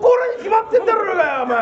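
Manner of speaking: high, heated manzai shout; angry comeback. Acted. Straight man scolding his partner, angry shout. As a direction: shout back in exasperation.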